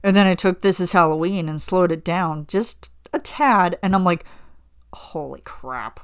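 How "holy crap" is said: hushed, slow, awed holy crap, much softer; hushed awe. Retold or imitated. Hearing the slowed song, hushed awed holy crap. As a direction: breathe holy crap out low in disbelief. Retold reaction.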